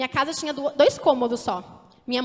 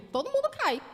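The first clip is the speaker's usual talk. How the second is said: repeats the phrase higher, with a pointed edge; pointed retort; 10 semitones higher than usual. Shown as a demonstration. Preacher answering her own quoted objection, pointed and high. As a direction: toss the line back up high to challenge it. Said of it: "Mas o contexto era diferente".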